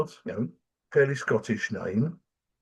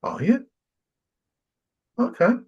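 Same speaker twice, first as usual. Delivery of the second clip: much higher, a playful mock surprise; higher, mock-astonished reply; 12 semitones higher, 4 dB louder than usual. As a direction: act amazed, tongue in cheek. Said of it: "I went".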